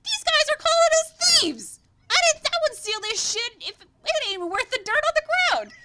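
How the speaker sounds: very high, loud indignant yell; outraged halfling. Acted. Sandstone halfling yelling at the accusers, very high and loud. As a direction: shout down the accusation. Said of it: "It's like".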